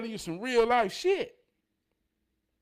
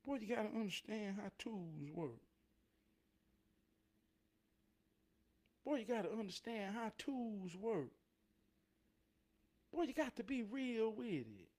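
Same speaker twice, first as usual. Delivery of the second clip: much softer, low-key mechanic voice; talking like the mechanic; 3 semitones lower, 16 dB quieter than usual. Retold or imitated. Still imitating the mechanic while reflecting, softer. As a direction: keep it soft and easygoing like the mechanic. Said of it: "That's why I'm talking like that".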